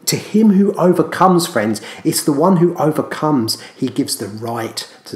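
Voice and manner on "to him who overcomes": higher and louder, stressing him; raised proclamation. Shown as a demonstration. Preacher repeating the verse to the church, raised and loud. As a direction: ring out the verse again with force. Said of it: "and it says".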